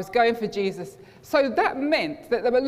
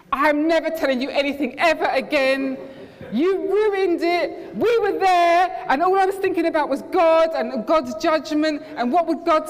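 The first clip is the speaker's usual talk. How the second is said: mad friend; 6 semitones higher, 4 dB louder than usual. Retold or imitated. Furious friend blaming narrator for her ruined night, shouting. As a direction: rant at him like a furious teenage friend. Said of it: "She was mad".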